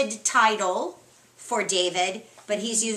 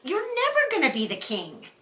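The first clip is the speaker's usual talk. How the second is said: mock outraged king; 11 semitones higher, faster than usual. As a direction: demand it like an outraged father. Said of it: "So he's telling him".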